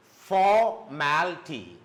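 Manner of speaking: for, mal, T each said apart; syllable by syllable. Shown as a demonstration. Teacher counting out three syllables, syllable by syllable. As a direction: punch for, then step down through mal and T. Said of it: "there are three syllables".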